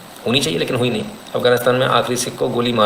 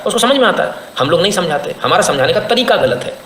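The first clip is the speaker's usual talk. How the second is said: ordinary delivery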